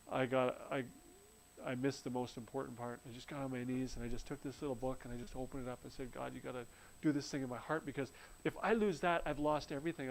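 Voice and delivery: soft, pleading prayer to God; hushed desperate prayer. Retold or imitated. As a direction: pray low and urgent, almost to yourself.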